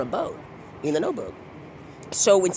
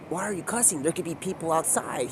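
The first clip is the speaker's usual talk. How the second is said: much softer, cautioning reproach; hushed scolding; 3 semitones lower, 10 dB quieter than usual. Acted. Warning him about cussing with people outside, hushed scolding. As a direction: chide under your voice so outsiders won't hear.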